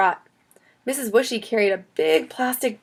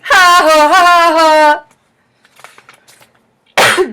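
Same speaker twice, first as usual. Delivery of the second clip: loud, high acted laugh; big hammed-up belly of ha-has; hysterical laughter; 9 semitones higher, 13 dB louder, much slower than usual. A narrator's reading. Max laughs at Chloe's worm scare, hysterical laughter. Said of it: "Max started laughing hysterically".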